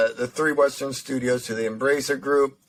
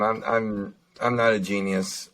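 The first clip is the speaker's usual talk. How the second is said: ordinary talk, nothing marked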